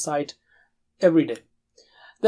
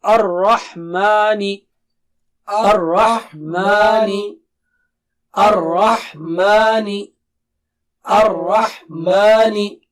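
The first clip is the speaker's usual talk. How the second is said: Ar-Rahmani slow, thick ra and clear ha; teacher drilling the whole word slowly; 3 dB louder, slower than usual. Shown as a demonstration. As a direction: recite it slowly, syllable by syllable. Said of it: "Let's recite the whole word".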